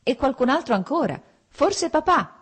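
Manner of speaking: high, rising excited call; excited shouting. A narrator's reading. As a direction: call out the news along the drive. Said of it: "gridò Lievin fermandosi all'ingresso del viale".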